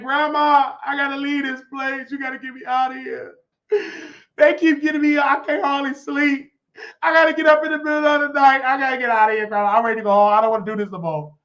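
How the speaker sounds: high, loud tearful pleading, hammed up; mock crying. Homesick recruit begging grandma by phone, mock crying. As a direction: wail to grandma like a homesick baby. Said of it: "Then I cried. I called my grandmother".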